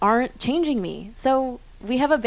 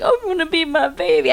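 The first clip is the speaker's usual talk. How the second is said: mock sobbing; 7 semitones higher, 6 dB louder than usual. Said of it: "and I was like".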